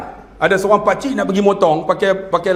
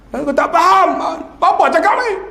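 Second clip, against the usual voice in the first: confused shout; 7 semitones higher than usual. Acted. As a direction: yell that you don't understand. Confused old man shouts back.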